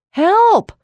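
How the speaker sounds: high, loud shout for help; crying for help. A narrator's reading. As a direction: yell it out, panicked. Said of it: "William cried".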